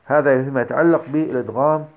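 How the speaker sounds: ordinary delivery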